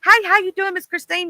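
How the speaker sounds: overly friendly Southern hello to a stranger; friendly Southern greeting. Retold or imitated. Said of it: "if we like".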